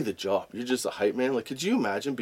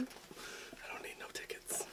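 whisper; 24 dB quieter, slower than usual. Acted. Jokey brag after ticket talk.

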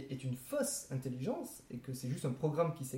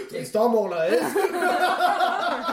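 laughing; 11 semitones higher, 11 dB louder, much slower than usual. Real, not acted. Laughing at friend's joke.

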